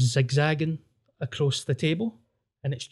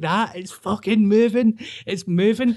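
mock panicked screaming; 6 semitones higher than usual. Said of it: "we're all screaming".

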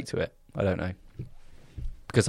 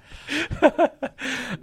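high laugh. Real, not acted. Laughing about partner's blunt feedback.

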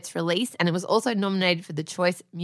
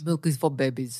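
Arnie impression; 7 semitones lower, 5 dB quieter than usual. Wife quoting Arnie in a lower mock Austrian voice. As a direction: lower Austrian-ish "Milk is for babies". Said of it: "He did say milk was for babies".

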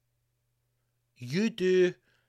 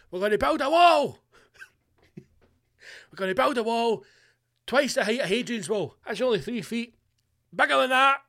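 rally speech; 7 semitones higher, 3 dB louder than usual. Pretend. Mock Trump-style rally promise.

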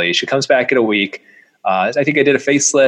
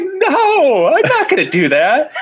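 high-pitched comic protest through laughter; laughing horrified refusal; slower than usual. Retold or imitated. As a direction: refuse in mock horror, breaking into laughs. Laughingly refusing the mentor's advice.

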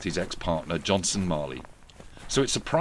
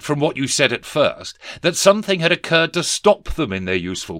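higher, louder boss voice of a sneering Scrooge; stern miser boss; 5 semitones higher, 6 dB louder than usual. Acted. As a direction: bark it down at the clerk. Scrooge relieved, gloating.

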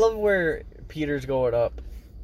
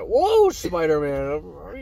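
mocking drawl; 4 semitones higher, 5 dB louder, much slower than usual. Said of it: "It's like".

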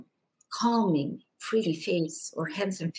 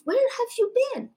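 much higher and faster, pressing the question; urgent elder plea; 3 dB louder, faster than usual. Retold or imitated. Pope pressing the child again, high and urgent. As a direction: repeat it, more urgent and up high.

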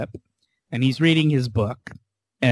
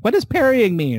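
imitating little boy; 9 semitones higher than usual. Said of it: "And the little boy's like".

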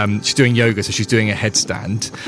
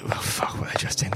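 whispered aside; 10 dB quieter, faster than usual. Real, not acted. GM's muttered aside to herself.

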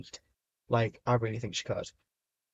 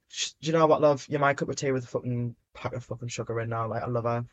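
ordinary delivery

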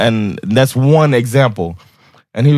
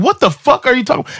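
much higher, disbelieving blurt; retold shock, pitched way up; incredulous; 13 semitones higher than usual. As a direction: throw it out in stunned disbelief.

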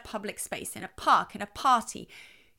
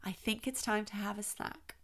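very soft; 9 dB quieter than usual. Shown as a demonstration. Parent suggesting a snack to an excited child, very softly. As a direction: say it gently, well under your usual volume. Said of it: "I would talk very softly".

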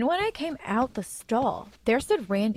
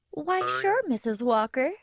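high-pitched sugary fake innocence; fake innocent; 5 semitones higher than usual. A narrator's reading. Randy answering the Cub Scout head, fake innocent. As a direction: start high and play the innocent. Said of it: "said in a fake, innocent voice".

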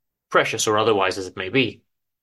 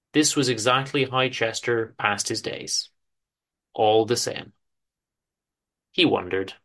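ordinary delivery, nothing marked

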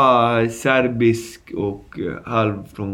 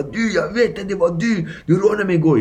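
insistent accusation; 8 semitones higher than usual. Retold or imitated. Victim insisting, retold.

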